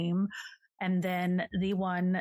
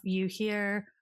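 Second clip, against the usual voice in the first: usual speaking voice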